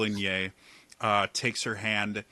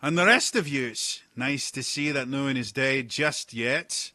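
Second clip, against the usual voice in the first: rough pirate captain; 4 semitones higher, slower than usual. Acted. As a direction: rib an old friend boisterously. Pirate captain greets the party.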